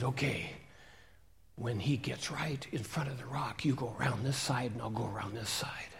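conspiratorial whisper. Acted. Robber whispering the ambush plan, hushed. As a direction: plot it under your breath.

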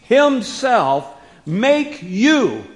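loud, high, slow preaching emphasis; hammered-out, weighty declaration; emphatic scripture reading. Acted. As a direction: drive each phrase home with force and pauses. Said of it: "Paul uses the emphatic Greek construction".

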